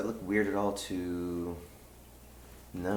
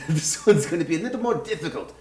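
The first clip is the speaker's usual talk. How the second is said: ordinary delivery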